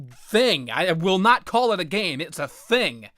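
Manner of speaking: normal talking voice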